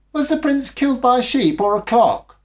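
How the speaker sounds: put-on high, loud child voice asking; child's question. A narrator's reading. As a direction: voice Cyril as an eager little boy. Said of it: "asked Cyril".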